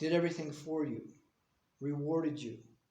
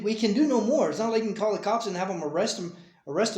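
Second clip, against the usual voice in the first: higher, louder protest of helplessness; exasperated defensiveness; 4 semitones higher, 5 dB louder than usual. Real, not acted. Parent tells child nothing more could be done, exasperated. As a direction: argue your case insistently, voice raised. Parent justifies not acting further.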